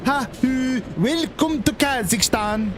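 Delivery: high, slow, hammed-up Scandinavian accent; greeting the border guard in a fake Scandinavian accent; mock Scandinavian accent. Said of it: "in an accent that is meant to be Scandinavian".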